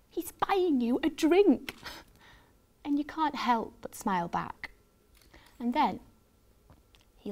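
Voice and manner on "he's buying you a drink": high, slow, whispery excitement; thrilled gasp. A narrator's reading. Fan is bought a drink by her crush, thrilled whisper. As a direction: gasp it in giddy disbelief.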